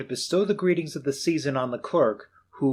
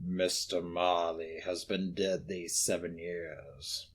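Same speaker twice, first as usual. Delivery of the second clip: low, slow, hushed reply; cold old miser; 8 semitones lower, 11 dB quieter, slower than usual. Acted. Scrooge telling the gentleman of Marley, low and cold. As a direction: answer flatly and coldly.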